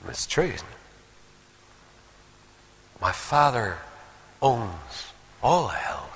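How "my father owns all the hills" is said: slow, near-whispered declaration; hushed awe. Acted. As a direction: let each phrase sink in, almost whispering. Preacher repeats the line slowly, hushed.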